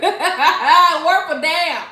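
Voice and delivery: drawn out slowly through laughter; slow laughing echo; laughing repeat. Real, not acted. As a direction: savor the words while laughing.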